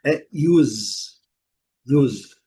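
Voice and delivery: "use" dito iuz, com i inicial; English use. Shown as a demonstration. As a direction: say "use" as yooz. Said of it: "a melhor pronúncia". ("use" pronunciado iuz, com som de Z)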